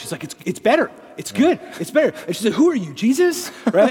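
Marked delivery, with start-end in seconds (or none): 0.2-2.1 high, louder amazed it's better; excited relief. Retold or imitated. An older Italian woman tells the man who prayed, in high delighted amazement. Woman's knee healed, retold. 2.5-3.5 high, louder astonished question; amazed question. Retold or imitated. The healed woman asks the praying man, high and astonished.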